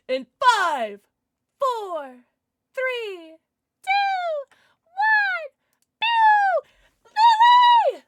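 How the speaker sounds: rising to a loud high squeal; building countdown. Real, not acted. Streamer counting down to the giveaway winner, building to a squeal.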